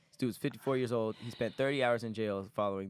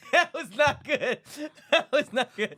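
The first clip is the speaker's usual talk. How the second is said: high, loud words through real laughter; helpless laughter; 13 semitones higher, 9 dB louder, slower than usual. Real, not acted. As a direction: crack up while saying it. Cracks up at failed impersonation.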